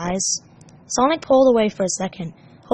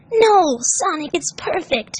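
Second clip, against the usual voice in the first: tender; 8 semitones higher, slower than usual. Acted. Tails adoring.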